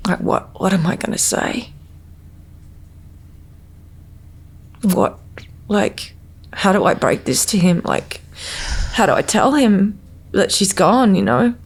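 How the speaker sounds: choked up, words catching high and shaky; fighting back tears while asking how to tell Steve; voice breaking. Real, not acted. Mother recalls learning her baby had died, voice breaking. Retelling the moment of loss.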